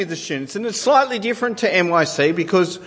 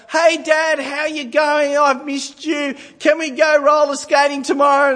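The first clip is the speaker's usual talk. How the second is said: put-on high, bright, pleading child voice; eager daughter; 10 semitones higher than usual. Daughter greeting dad and begging for roller skating, high and eager. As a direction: ask dad sweetly for a treat. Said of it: "Then my daughter".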